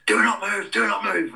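high, loud drill-sergeant bark; retold commanding shout; shouted order. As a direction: yell it down at recruits lying on the floor. Instructor ambushes recruits, retold command.

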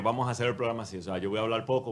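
normal talking voice

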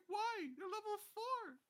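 high, incredulous complaint; exasperated. Retold or imitated. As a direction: protest in disbelief. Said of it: "And I'm like".

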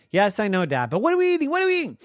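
put-on high, pushy child demand; impatient kid. Piper demanding food.

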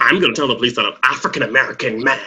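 a mildly mocking, put-on snooty voice; condescending. Imitating Karen telling the birdwatcher off, condescending. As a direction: look down your nose at him. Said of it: "She was very condescending".

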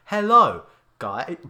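stiff, loud robot voice greeting; robotic announcer hello. Acted. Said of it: "I sound like a robot".

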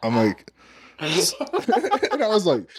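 high and slow, laughing through it. Real, not acted. Retelling the store story, laughing hard. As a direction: crack up mid-sentence.

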